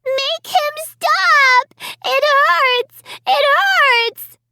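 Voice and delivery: put-on screaming child in pain. A narrator's reading.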